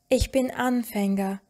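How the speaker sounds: ordinary talk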